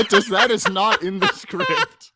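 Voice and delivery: loud, high words squeezed through laughter; laughing hard. Real, not acted. Cracking up at his co-host's dramatic reading, loud and high. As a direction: barely get it out for laughing.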